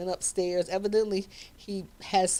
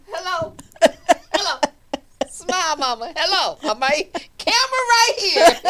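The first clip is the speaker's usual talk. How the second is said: laughing, rising louder call to smile; excited calling; 10 semitones higher, 10 dB louder than usual. Retold or imitated. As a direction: coax a frozen mother into the photo. Urging starstruck mother to smile.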